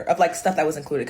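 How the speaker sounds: usual speaking voice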